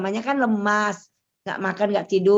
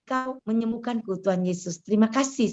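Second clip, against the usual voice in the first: heartfelt prayer; 2 semitones higher than usual. Acted. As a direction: pray it gently, as if alone with God. Prays aloud over music.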